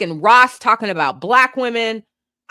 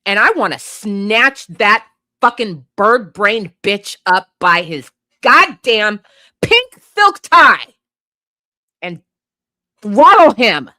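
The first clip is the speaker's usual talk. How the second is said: building furious yell; 6 dB louder than usual. Real, not acted. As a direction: let the rage explode word by word. Furious rant, wants to throttle him.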